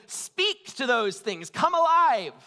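slow, then a loud high cry on "come alive"; mock command. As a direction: build from a slow, drawn-out line to a loud high shout. Quoting idol worshippers' commands.

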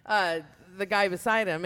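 ordinary talk, nothing marked

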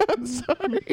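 high, giggly apology; laughing mock apology. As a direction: apologize while cracking up. Acting apologizing driver, breaking into laughter.

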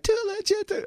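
Too legit squeaked very high. Shown as a demonstration. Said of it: "this one higher".

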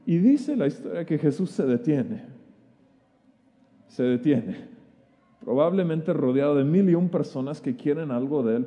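ordinary delivery

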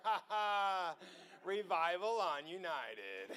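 real laughter through slow words; laugh, slow the phrase, laugh on; delighted laughing. Real, not acted. Traveler celebrating revival on a flight, laughing.

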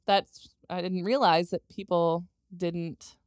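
usual speaking voice

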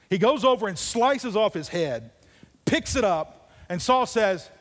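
loud, high preacher storytelling; fiery sermon narration; preaching at full force. Acted. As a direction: drive the story home to the congregation.

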